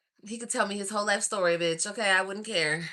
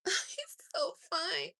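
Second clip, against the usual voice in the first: swooning; 11 semitones higher, 8 dB quieter, slower than usual. Real, not acted. Swooning over his photo.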